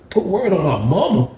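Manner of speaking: high-pitched, disbelieving question; incredulous echo. Retold or imitated. Challenged by Leroy to swear, high incredulous echo.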